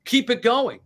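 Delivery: high-pitched mock angry order; retold indignant insistence, voice jumping up; angry demand. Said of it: "they get angry that it stops".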